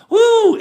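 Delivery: high loud woo; excited woo. Real, not acted. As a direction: let out a burst of joy. Excited yelp while reading chat.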